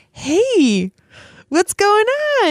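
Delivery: high, light concerned ask; concerned question. Retold or imitated. Asking upset husband.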